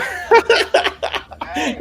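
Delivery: breathy high burst of laughter; laughing. Real, not acted. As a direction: crack up at the clip. Big laugh at clip.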